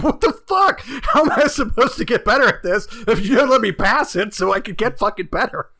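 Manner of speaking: loud high laughing rant; exasperated yell. Retold or imitated. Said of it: "And I'm like".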